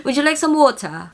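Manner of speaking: water as "wo-tuh", T kept, R dropped; R not emphasized. Shown as a demonstration. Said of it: "don't emphasize the R word".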